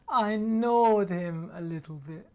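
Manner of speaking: slow, drawn-out mock-innocent child; imitating the cheeky daughter. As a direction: stretch it out, sweetly defiant. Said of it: "That's what she said".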